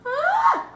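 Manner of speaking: sharp high squeal; excited squeal. Real, not acted. She squeals as the bleach starts working. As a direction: let out a thrilled squeal.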